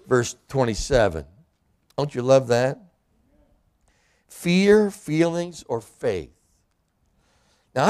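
ordinary talk

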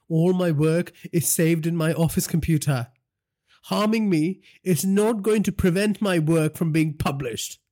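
tied-up journalist defying the boss; defiant journalist. Acted. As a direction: throw it back at him. Journalist character voice.

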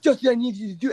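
high, frantic gabble imitating a coked-out man; coked-out fast talker. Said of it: "like he was in a kung fu movie".

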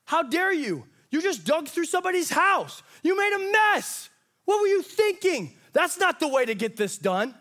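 indignant critic scolding. Retold or imitated. Critics rebuking Bubba and Bo, mock outrage.

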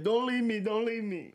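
high, whiny pleading cry; mock pleading. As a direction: beg in a high whine.

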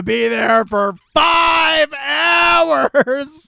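high, loud yell with drawn-out words; hammed-up indignant holler; acted outrage. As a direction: stretch each word into an outraged shout. Acted outrage of reluctant plus ones.

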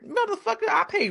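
high, shocked curse; indignant outburst. Retold or imitated. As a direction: burst out at the intruders. Retold intrusion, indignant.

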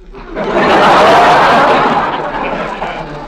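loud giddy laugh. Acted. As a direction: laugh nervously. Nervous laugh.

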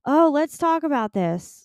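mock-bright invitation to her early viewers; excited self-quote. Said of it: "And I was like".

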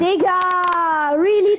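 exasperated. Real, not acted. Baufight verloren.

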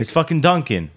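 hammy Boston Dunkin' order; Boston accent. Pretend. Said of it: "a Boston accent for Dunkin'".

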